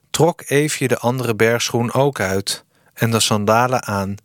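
ordinary delivery

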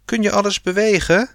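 high, quick yell without much volume; a narrator's light version of a shrieked question; mock yell. A narrator's reading. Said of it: "gilde Sanne". (hoog en snel gegild, ingehouden)

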